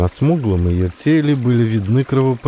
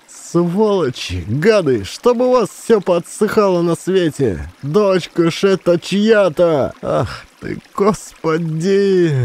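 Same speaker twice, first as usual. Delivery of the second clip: slow, high weeping curses; tearful cursing; 8 semitones higher, slower than usual. A narrator's reading. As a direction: curse through tears. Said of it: "Сморкалась и плакала баба". (баба плачет и бранится)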